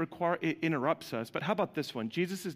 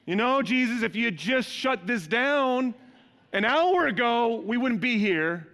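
irritated disciples; 8 semitones higher, 7 dB louder than usual. Retold or imitated. Disciples complaining to Jesus.

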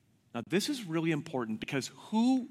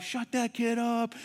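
pitched-up retold yell; retold shout; 7 semitones higher than usual. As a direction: imitate an irritated stranger's shout. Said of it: "Someone shouts at my wife".

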